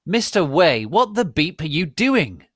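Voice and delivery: high, loud shrill scolding; angry shout. Acted. Rumbridge scolding the student, high loud shout. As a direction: bark it at the student. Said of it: "Rumbridge shouted angrily".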